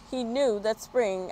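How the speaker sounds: usual speaking voice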